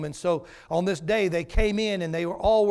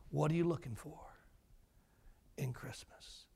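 fading whisper; 3 semitones lower, 13 dB quieter than usual. Acted.

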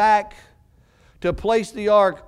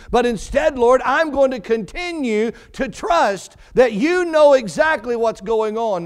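raised, ringing preacher's prayer; fervent preaching; 4 semitones higher, 3 dB louder than usual. Acted. Preacher voicing David's prayer to his congregation, fervent and raised. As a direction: pour it out to the Lord with conviction.

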